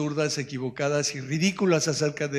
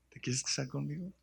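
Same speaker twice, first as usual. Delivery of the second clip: very soft tender question; tender proposal; 11 dB quieter than usual. Retold or imitated. Husband recalls asking his wife, tender. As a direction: ask it tenderly.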